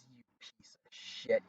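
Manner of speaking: slow, voiceless mutter of disgust; imitating a grudging whisper; retold whispered curse. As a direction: hiss it under your breath, as a sore loser. Rival's grudging reaction to bullseye.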